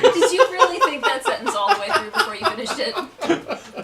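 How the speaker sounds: laughing. Real, not acted. Teasing friend over awkward sentence.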